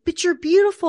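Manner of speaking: high, loud, gushing "you're beautiful"; over-eager reassuring parent. Retold or imitated. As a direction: gush it, far too eager to fix. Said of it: "we're so inclined to be like".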